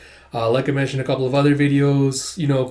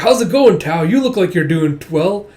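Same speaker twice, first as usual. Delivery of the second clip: bubbly, higher-pitched hello; cheerful greeting; 4 semitones higher, 5 dB louder than usual. Acted. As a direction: greet an old friend eagerly. Same cheerful character greets Tao.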